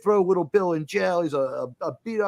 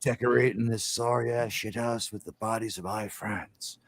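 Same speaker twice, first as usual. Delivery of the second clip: movie gunslinger menace; 5 semitones lower, 7 dB quieter than usual. Retold or imitated. Gunslinger answering in the bar showdown, low quiet imitated menace.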